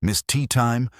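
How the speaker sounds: ordinary talk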